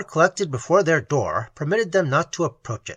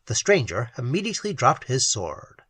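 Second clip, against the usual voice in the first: ordinary delivery, nothing marked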